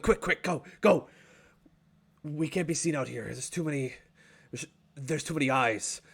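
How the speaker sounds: hurried, hushed warning; lightly voiced nervous dwarf; urgent dwarf. Dwarf hurries party inside.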